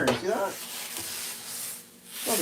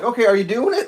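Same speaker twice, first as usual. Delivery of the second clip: mock official; 7 semitones higher, 5 dB louder than usual. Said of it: "no one was like".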